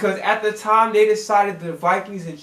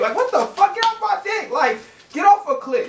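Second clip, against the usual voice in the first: higher, sharp exasperated yell; indignant outburst; 9 semitones higher than usual. Real, not acted. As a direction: snap at them in disbelief. Angry rant at creepy coverage.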